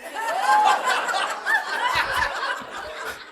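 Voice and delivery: shrieking laugh well above his voice; high burst of laughter. Real, not acted. Speaker laughing at his own gas station story, high squeal.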